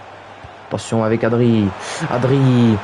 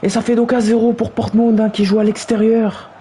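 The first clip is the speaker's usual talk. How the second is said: higher, buoyant recap of the score; still excited; 8 semitones higher than usual. Acted. Commentator recapping the away lead, still elated and higher. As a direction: ride the leftover joy of the goal.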